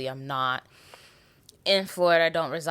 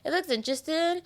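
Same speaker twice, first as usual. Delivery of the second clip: mock intrigue; 14 semitones higher than usual. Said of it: "I was like".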